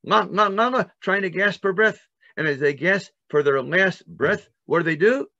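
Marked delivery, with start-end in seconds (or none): 0.1-0.9 higher, louder choking gasps; mock gasps of drowning Egyptians. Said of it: "hear the Egyptians saying".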